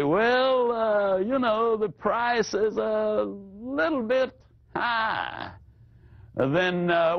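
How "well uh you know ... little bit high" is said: high, slow, tearful whine; whiny buyer. Retold or imitated. Prospect complaining about price, high whining voice. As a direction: plead that the price hurts. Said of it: "one prospect might say".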